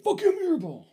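high-pitched grumbling yell; mock exasperated outburst. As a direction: blurt it out high, fed up with the errand. Mock exasperation at forced errand.

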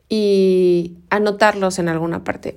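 ordinary talk, nothing marked

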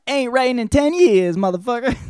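a woman fiercely denying her age; sassy lady quote. Retold or imitated. Ladies lying about their age.

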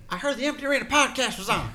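high, loud mock wrestler voice, laughing; wrestler promo voice. Co-host joins the wrestler bit, high and laughing. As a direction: ham it up like a promo, giggling.

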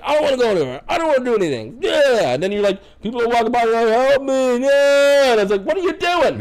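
exasperated rant. Retold or imitated. Paramedic ranting at repeat caller.

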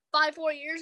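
ordinary talk, nothing marked

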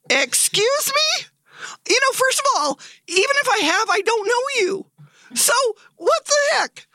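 high, mock-outraged disbelief; high indignant "excuse me" in a half-acted retelling; indignant shock. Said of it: "Very calm in the moment".

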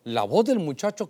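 reading the verse slowly and low; slow scripture reading. Acted. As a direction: read it like holy scripture, drawn out. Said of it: "dice en el verso diecisiete".

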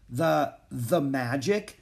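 slow, halting, tentative suggestion; hesitant hinting. Acted. Annie suggests magic to Jack, slow and hesitant. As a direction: draw it out as a careful hint.

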